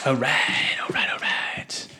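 near-whisper, drawn out; whispering to self. Real, not acted. Player to self over the dice roll, whispered. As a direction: mutter it slowly under the breath.

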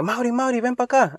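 a father calling his son to come over. Retold or imitated.

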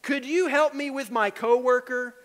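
pleading request. Shown as a demonstration. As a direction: beg for help on the coworker.

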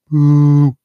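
loud drawn-out boo; crowd booing. Retold or imitated. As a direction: boo him off stage. Crowd booing [name], retold.

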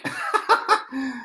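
short high giggle; real laugh. Real, not acted. Poet back at the desk, real laugh. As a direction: let out a quick high laugh.

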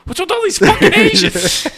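high, very loud shout through laughter; hammed-up bigot's outburst; mock outraged yell. Retelling a comedian's restaurant bit, yelling in mock outrage. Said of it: "It'd be different if you're like".

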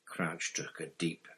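ordinary delivery

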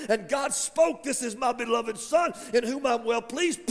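high, loud pulpit proclamation; fired-up sermon crescendo; impassioned preaching. Real, not acted. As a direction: drive it home at full force, stretching key words.

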